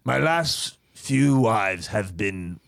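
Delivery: hesitant, affected confession; pompous rich bachelor. Acted. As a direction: confide like a self-important millionaire.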